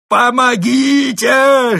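very high, drawn-out scream for help; desperate yell. Acted. Trapped Mole calling for rescue, screamed at full force. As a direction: scream it with all your might. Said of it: "что есть сил, завопил крот".

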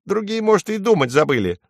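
high little sparrow voice; high-pitched, defiant sparrow; stubborn sparrow. A narrator's reading. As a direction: argue back as a small bird. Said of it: "упирается воробей".

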